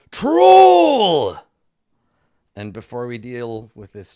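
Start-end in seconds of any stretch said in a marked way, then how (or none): 0.1-1.4 huge, high, drawn-out alarm bellow; full-force yell, stretched out long; barkeep's shout. Acted. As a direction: shout the warning across a whole tavern. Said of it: "who simply shouts".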